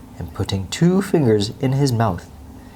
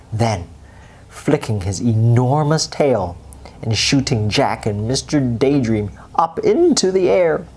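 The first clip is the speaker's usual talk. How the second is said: usual speaking voice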